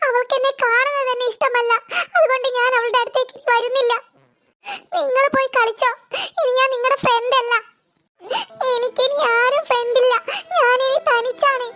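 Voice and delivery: acted sobbing refusal; tearful, giving up on the friends; crying. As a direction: catch a sobbing breath between phrases. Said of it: "നീ കരയാതെ എഴുന്നേൽക്ക്".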